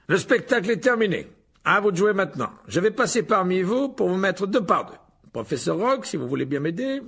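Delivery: loud, high, theatrical host; pompous showman teacher. A narrator's reading. Lockhart presents his duelling club to the pupils, pompous and projected. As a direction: play the vain professor addressing a crowd.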